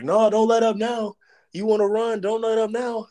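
high, loud coach yell; coaches yelling. Retold or imitated. Coaches yelling at a tired freshman to keep pushing, high loud shout. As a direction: bark it like a coach pushing the last rep. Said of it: "all the coaches was like".

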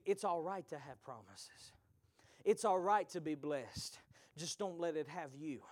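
usual speaking voice

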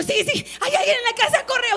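high, loud panicked cry; hysterical. Retold or imitated. Pastor retelling her frantic cry to her son to run, high and loud. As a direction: panic and laugh at the same time. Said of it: "yo súper histérica".

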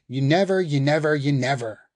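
mocking his words back at him, venomous; spitting each word. A narrator's reading. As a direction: spit every word at her. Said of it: "spits each word".

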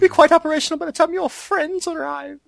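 very high cackling evil voice; hammy Emperor. Retold or imitated. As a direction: overdo the evil old emperor. Hammed Emperor quote.

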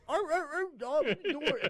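put-on high yapping arr arr at the baby; mock dog barking. Talking to a baby, miming the dog with high barks. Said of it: "make motions".

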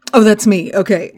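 ordinary delivery

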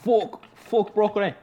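exaggerated, worse attempt at his accent; Masai Ujiri. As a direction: overdo a badly faked accent. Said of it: "No, that was worse".

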